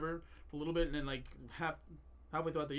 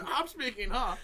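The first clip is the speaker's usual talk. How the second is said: loud, higher mock echo of the moderator; 5 semitones higher, 6 dB louder, slower than usual. Podcast guest parrots the moderator, mocking. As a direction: parrot it back loudly, laughing.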